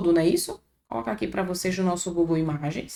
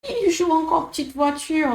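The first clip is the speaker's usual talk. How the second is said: mildly higher mock scorn; lightly acted teasing; imitating the girl; 5 semitones higher than usual. Teacher lightly imitating the girl's teasing line, a bit higher. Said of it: "Esse bête aqui é no sentido de besta".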